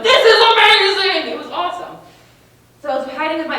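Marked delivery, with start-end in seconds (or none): 0.0-1.3 high, loud squeal of joy; kid thrilled. Retold or imitated. Nine-year-old running through a strange school, loud and gleeful. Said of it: "and like".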